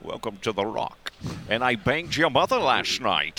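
put-on movie star accent; Sean Connery. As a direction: imitate the actor's famous accent. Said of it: "if you're talking about Connery".

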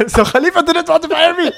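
high-pitched mock Ali with laughter; relieved employee. Ali realises the VP wasn't firing him, high and laughing. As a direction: play the clueless junior realising it.

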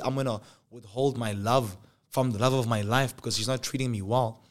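ordinary talk

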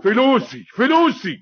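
very high, loud repeated shout; furious demand. Acted. Cheated man demanding his money, furious. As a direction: shout it desperately for everyone to hear.

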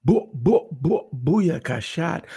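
loud drawn-out hype call; hype shout. Acted. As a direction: shout the intro catchphrase. Hype intro catchphrase.

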